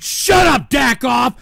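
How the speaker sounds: high, loud snapped shout; furious retort; pissed off. Real, not acted. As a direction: yell back at a heckler. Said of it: "I'm pissed".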